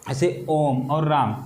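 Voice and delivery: ओम and राम each held long on the vowel; drawn-out Om and Ram. Shown as a demonstration. Teacher showing students a prolonged vowel. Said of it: "इसको कहते हैं".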